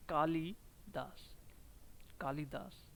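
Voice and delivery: कालिदास said slowly and softly with a short लि; कालिदास, the short लि kept light; slow model word. Shown as a demonstration. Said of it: "कालिदास में छोटी ई की मात्रा आती है". (कालिदास, धीरे और नरम)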